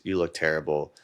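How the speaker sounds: ordinary talk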